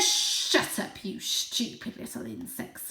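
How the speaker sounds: breathy whispered snarl. Acted. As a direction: spit the insult in a hiss. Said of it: "snarled Julius".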